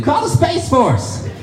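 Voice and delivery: high, drawn-out mock alarm; freaking out. Audience member alerting the authorities, freaking out. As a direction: cry out in mock panic. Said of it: "they freak out".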